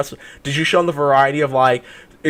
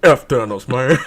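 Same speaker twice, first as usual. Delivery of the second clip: deep bass voice; 3 semitones lower, 4 dB louder than usual. Retold or imitated. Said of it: "he put some bass in his voice".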